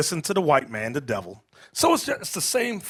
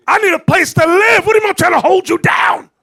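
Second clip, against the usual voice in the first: much higher, louder outraged yell; shouted protest of a man fresh from jail; indignant shout; 11 semitones higher, 9 dB louder than usual. Retold or imitated. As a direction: shout it at the feminist in disbelief.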